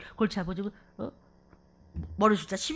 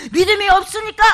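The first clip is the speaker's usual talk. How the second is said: loud, high cry with a break in the voice; 8 semitones higher, 12 dB louder than usual. Real, not acted. Preacher lamenting the faithless, crying out loud. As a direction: wail it as a heartbroken charge.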